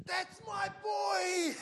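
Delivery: drawn-out, high-pitched proud shout; screaming. Retold or imitated. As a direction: holler it like a dad cheering his son on. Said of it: "heard this man screaming".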